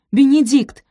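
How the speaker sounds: high, loud call of his name; urgent exclamation. A narrator's reading. Sophie shaking sleeping Benedict, urgent exclamation. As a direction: call out to wake him. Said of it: "воскликнула она".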